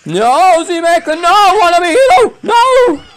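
high, loud wailing no; hammed-up despair, climbing into a squeal; anguished cries. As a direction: cry out for your fallen heroes. Dramatic despair as fighters fall.

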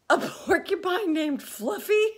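high and loud, howling with laughter at the name. Acted. Rhinoceros howling with laughter at Fluffy's name. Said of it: "howled the rhinoceros".

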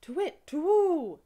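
high, loud, slow screech of a cornered owl; harsh owl cry. Acted. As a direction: shriek it like a frightened owl. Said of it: "and cried ... in a harsh voice".

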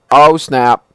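loud oh snap. Real, not acted. Repeated exclamation.

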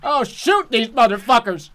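loud high outburst; loud yell. Real, not acted. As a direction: shout it out. Yelling for shooting.

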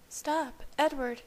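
pulling away with a high gasp; gasped protest. A narrator's reading. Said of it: "she gasped".

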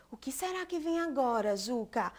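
suspenseful whisper. Acted. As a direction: lean in and whisper the secret. Suspense before next toy.

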